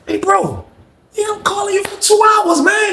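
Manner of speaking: high shouted call. Retold or imitated. Narrator calling to Jeff for hours through the door, shouting. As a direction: yell it through the door, impatient.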